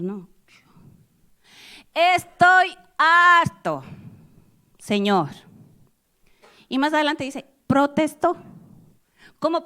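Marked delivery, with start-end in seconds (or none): 1.9-5.3 high, slow, loud cry of exhaustion; fed-up outburst. Acted. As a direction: shout out being fed up. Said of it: "aquí vienen los signos".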